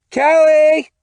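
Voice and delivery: high, loud shout; mother yelling. Acted. Mother yelling her daughter's name through the wall, high and loud. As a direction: yell up through the house at the daughter. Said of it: "Missus Carpenter yells again".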